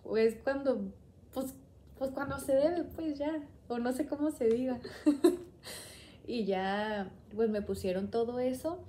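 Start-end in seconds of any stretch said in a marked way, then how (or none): 4.8-5.9 airy, sheepish laugh; embarrassed laugh. Real, not acted. Searching for the right word, a sheepish laugh. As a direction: laugh off the fumble. Laughs after fumbling words.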